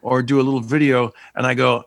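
ordinary talk

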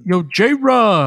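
loud bro greeting; frat bro. Pretend. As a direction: shout it like a frat guy.

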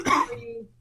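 choking on a drink mid-sentence; sputtering after swallowing wrong; coughing up water. Real, not acted. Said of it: "I went down the wrong pipe".

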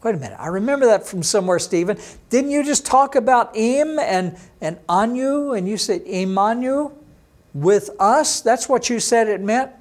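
quick and high, a student who just caught on; mock surprised listener. Teacher playing a puzzled student who suddenly remembers Immanuel, excited. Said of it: "voices a surprised listener".

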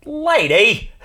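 high, loud Jerry Lewis yelp; Jerry Lewis shout. Retold or imitated. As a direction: yell it like a zany comic. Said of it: "immediately laughed to myself and thought of Jerry Lewis".

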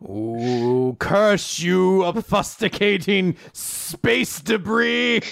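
high, slow, hammy villain curse; sputtering foiled villain. Pretend. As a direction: shake your fist at the rocks.

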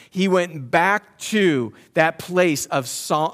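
loud, slow, emphatic sermon delivery; full-force preaching. Acted. Preacher urging the congregation that God stayed faithful to David, loud and slow. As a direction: lean on every phrase and hammer it home.

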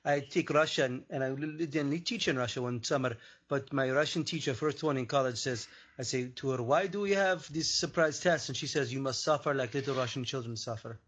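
put-on storytelling in broken Russian-accented English; put-on Slavic accent; mock Russian accent. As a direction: tell it as a Russian with halting grammar.

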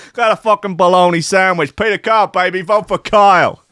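hammed-up Italian American tough guy; Italian American accent. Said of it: "he might be American Italian".

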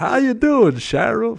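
loud, high-pitched mock Southern drawl; Peter Stormare's fake Southern accent. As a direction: belt out a badly faked Southern accent. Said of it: "Including fake Southern Peter Stormare".

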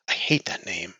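muffled under a blanket. Acted. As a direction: sulk from under covers. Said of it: "blanket-muffled voice".

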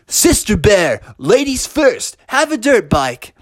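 high, showy announcement; gallant employee. Acted. Employee offering Sister Bear her bike first, showy gallant voice. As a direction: present it with playful flourish. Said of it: "rolled it out to Sister Bear and said".

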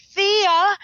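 high, loud call of a name; acted calling out. Film character calls to the girl going off, mock alarmed call. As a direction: call out sharply as a movie character. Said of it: "Ellen's like".

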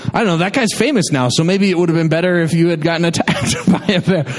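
ordinary talk

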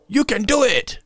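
high shouted catchphrase; movie quote impression. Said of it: "this is a quote".

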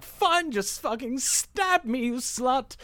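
high, melodramatic dare; Javert. Acted. Javert daring his captor with the knife, melodramatic. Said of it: "he's like".

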